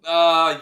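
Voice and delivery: loud sudden "ah!" of annoyance; frustrated yell. Real, not acted. Frustrated at enemy slugs.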